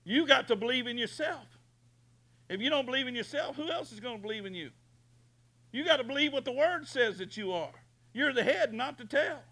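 high, fast pep talk; booming motivational speech. Acted. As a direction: fire them up, quick and high. Motivational preaching climax.

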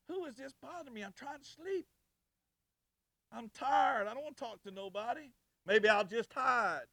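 high-pitched mock complaint; acted annoyance. Preacher replaying his reaction to an unexpected visitor, acting put out. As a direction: grumble it high, as if kept from sleep.

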